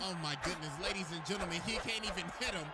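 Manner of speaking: rising, hyped boxing commentator; ringside commentary. Acted. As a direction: call the punches like a ringside announcer.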